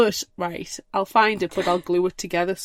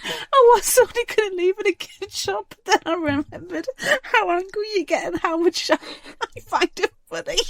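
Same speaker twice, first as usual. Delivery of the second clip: high, giggly, words breaking into laughter; helpless laughter squeezing the voice high; laughing while talking; 11 semitones higher than usual. Real, not acted. Teasing a friend about hating wind chimes, laughing through it.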